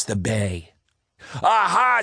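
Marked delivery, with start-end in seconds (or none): 1.4-2.0 high, loud triumphant aha; gleeful pirate aha. Acted. Pirate captain spotting a boat, gleeful high aha. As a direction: crow it like a pirate spotting prey.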